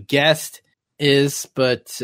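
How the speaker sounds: ordinary delivery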